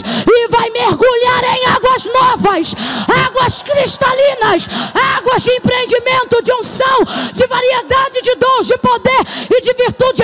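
loud, high, shouted prophecy with slow stretched calls; full-force preaching. Acted. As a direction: proclaim it like a prophet over the crowd, at full voice. (pregação profética aos gritos)

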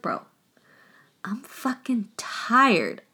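slow, breathless whisper of a worn-out pirate captain; weary whisper. Retold or imitated. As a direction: sigh it out, drained after twenty years. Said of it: "she's like".

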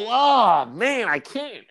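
high, drawn-out comic regret; pained mock refusal. Guest turning down the client's beer, pained. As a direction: groan the refusal, hammed up.

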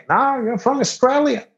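high-pitched mock contradiction; high, insistent retold voice; insistent local.

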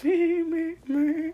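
high-pitched little nonsense squeaks; giggly squeak. Retold or imitated. Clerk failing to sell condoms seriously, high giggly squeak. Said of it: "I giggled".

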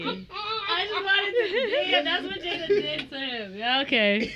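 laughing while talking; words broken by laughter. Real, not acted. Laughing hard after phone mishap.

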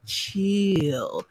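smooth, drawn-out delivery; imitating a smooth talker; Wampus. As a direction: stretch the word out softly, all smooth. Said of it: "Wampus says".

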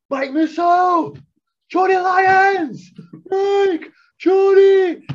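put-on long drawn-out drunken yell into the dark; loud drunken calling out; shouting names. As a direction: call out across a field, stretching each name. Drunk friend shouting names outside.

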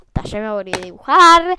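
ordinary talk

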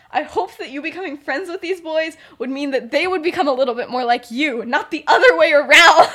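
high-pitched telling-off building to a mock shout; exasperated scolding. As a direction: scold a favourite pupil, exploding at the end. McGonagall scolding Lily, acted.

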